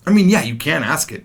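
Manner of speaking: normal talking voice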